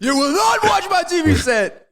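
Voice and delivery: high, strained mock yell; imitating movie line. Quoting the cop yelling about the TV. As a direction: yell it like the enraged character.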